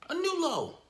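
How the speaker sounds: high, strained repeat of the phrase; mock anguished echo of his own words; despairing squeak. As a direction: squeeze it out in a pained high voice.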